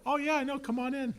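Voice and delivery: high, carefree welcome at the palace door; mock easygoing gatekeeper, pitched high; breezy doorman. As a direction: wave them in like it's nothing. Guards casually admitting two strangers.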